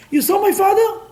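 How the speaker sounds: put-on high, loud child's question; a cocky kid calling out from his father's shoulders; boy asking. Boy on shoulders asks passerby.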